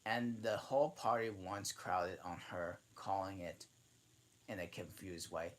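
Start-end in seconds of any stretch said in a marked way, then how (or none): none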